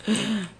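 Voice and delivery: high startled intake of breath; sharp gasp. Real, not acted. Gamer realizing danger, sharp startled gasp. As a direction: gasp as it dawns on you.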